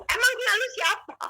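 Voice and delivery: high-pitched, re-enacted retort; indignant challenge. Challenging the unseen voice's identity, indignant. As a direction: demand to know who dares speak.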